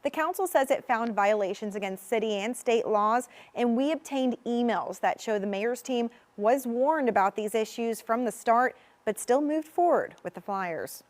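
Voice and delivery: measured reporter's news read; investigative news exposé. A narrator's reading.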